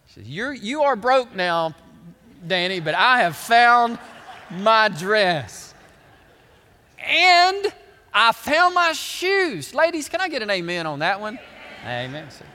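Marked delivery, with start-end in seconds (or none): 0.3-5.7 loud, drawn-out triumphant news; happy wife, imitated. Wife telling husband about her dress, hammed-up happy. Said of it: "She's so happy". 7.1-9.7 high, loud, slow crowing; happy wife, imitated. Wife adding news of the shoes, hammed-up happy. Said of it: "She's so happy".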